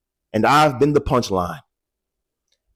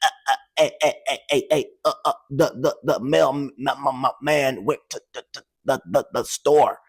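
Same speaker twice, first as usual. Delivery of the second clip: repeated eh and the, stuttering, getting softer; mock stuttering read-aloud; imitating a stutter; 4 dB quieter, faster than usual. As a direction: sputter and stall on each word. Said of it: "I was like".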